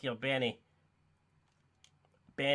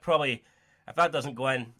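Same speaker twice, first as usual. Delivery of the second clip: ordinary talk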